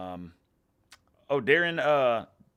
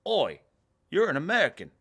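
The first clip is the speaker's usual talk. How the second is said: put-on British accent; 7 semitones higher, 7 dB louder than usual. British fans spotting him at match.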